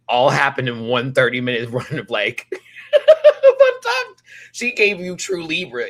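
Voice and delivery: talking through big laughs; laughing. Real, not acted. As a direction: laugh while getting it out. Laughing mid-sentence.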